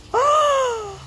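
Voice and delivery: long high voiced gasp; amazed gasp. Reader seeing Ted's costume, amazed gasp. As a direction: gasp in wonder at the reveal.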